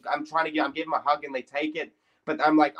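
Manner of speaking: ordinary talk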